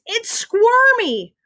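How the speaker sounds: very high, drawn-out squeal; shriek. A narrator's reading. Ryan holds the wriggling mouse, shrieking. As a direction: shriek it slowly, squealing the word. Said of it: "he shrieks".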